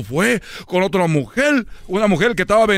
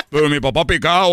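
usual speaking voice

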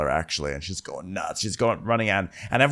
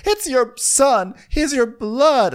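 high, loud, drawn-out queenly appeal; hammed-up wife demanding he take her side; mock outraged plea; 6 dB louder, slower than usual. Acted queen pleading with husband.

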